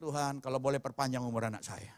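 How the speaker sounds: a hushed re-enactment of crying out; retold cry to God. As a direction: plead with God in a low, quiet voice. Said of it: "saya berteriak".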